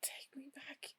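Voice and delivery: barely audible whispered plea; very soft, voiceless whispering; faint whisper. Acted. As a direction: whisper it as if drained by the vision. Shaken character confronts Trixie after vision.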